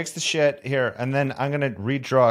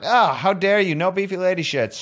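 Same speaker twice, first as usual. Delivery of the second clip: mock outrage; 7 semitones higher, 4 dB louder than usual. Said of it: "everyone's like".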